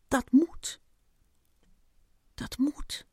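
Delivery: fading to near whisper. A narrator's reading. Ant repeating himself while pacing, fading out. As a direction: repeat it, dying away. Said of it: "maar wel steeds zachter".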